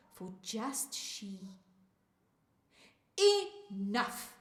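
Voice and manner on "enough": loud, firm reprimand; scolding. A narrator's reading. Mama cuts off Naledi's singing at dinner, scolding. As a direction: put your foot down loudly. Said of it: "Mama scolds".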